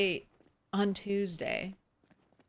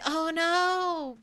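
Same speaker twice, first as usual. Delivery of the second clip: mock alarm; 9 semitones higher than usual. Real, not acted. Lemonade missing.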